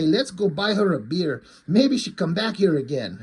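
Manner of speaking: lightly put-on Mexican accent, eager and chatty; a hint of a Mexican guy's accent talking up his buddy; mild comic Mexican accent. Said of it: "this little, short, fat Mexican dude".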